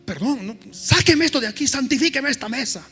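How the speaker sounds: high, loud hammed-up alarm; mock horrified panic. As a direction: shriek it like the table is poisoned. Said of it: "el pastor dice".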